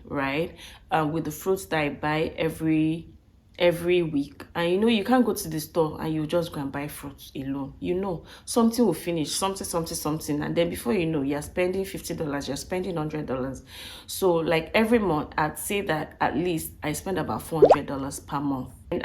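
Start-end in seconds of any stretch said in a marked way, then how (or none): none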